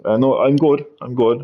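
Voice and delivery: normal talking voice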